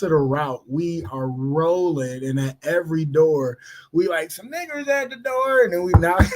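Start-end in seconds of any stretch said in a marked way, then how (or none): 4.3-5.6 mocking kid voice. Mocking the kid at every door.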